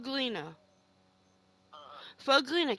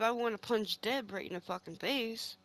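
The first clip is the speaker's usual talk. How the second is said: usual speaking voice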